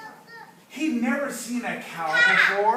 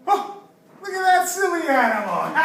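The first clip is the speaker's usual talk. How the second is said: playful amazement; 9 semitones higher, 6 dB louder than usual. Pretend. Teacher showing children a silly animal, playful amazement. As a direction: marvel at it for little children.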